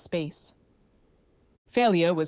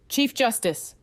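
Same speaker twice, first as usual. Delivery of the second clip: raised call through a door; shouting; 6 semitones higher, slower than usual. Acted. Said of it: "he shouted".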